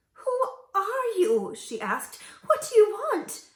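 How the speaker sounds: high, slow, fearful question; frightened. A narrator's reading. Old woman frightened of the princess. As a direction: shrink back in fear. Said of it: "she was frightened".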